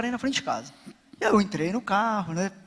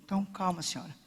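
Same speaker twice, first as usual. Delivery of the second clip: calming; 2 semitones lower, 12 dB quieter than usual. Retold or imitated. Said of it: "Eu falei".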